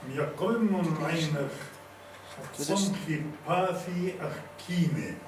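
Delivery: measured poetic delivery with drawn-out words; poem recitation. Acted. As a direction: declaim each line slowly, pausing between phrases.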